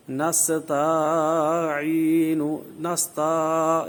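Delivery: نستعین with the ta stretched long and slow; wrong drawn-out ta. Shown as a demonstration. Teacher mimicking reciters who stretch the ta, slow. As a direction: drag the ta of نستعین too long. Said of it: "تا کو دراز کہا جاتے ہیں".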